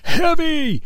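high-pitched imitation of a straining toddler; toddler's straining yell. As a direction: squeal it like a little girl heaving a brick. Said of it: "she would grunt and yell".

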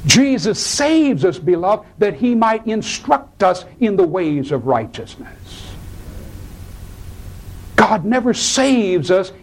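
high-pitched, driving sermon delivery; impassioned preaching. Real, not acted. Preacher explains Peter's mistake to the congregation, impassioned preaching. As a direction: preach it with rising fervor.

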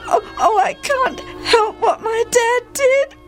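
put-on high, broken, tearful boy's voice; sobbing. A narrator's reading. Jephthah outside alone, praying to God through sobs. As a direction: sob a halting prayer, catching on the words. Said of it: "He sobbed".

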